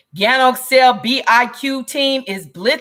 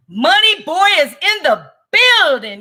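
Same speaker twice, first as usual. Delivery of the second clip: higher, drawn-out hype announcement; hyped shout-out; 5 semitones higher, 4 dB louder, slower than usual. Real, not acted. Streamer hails a friend joining the show, hyped shout-out. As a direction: announce him with big, stretched-out excitement. Hyping a guest's arrival.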